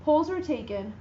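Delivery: ordinary delivery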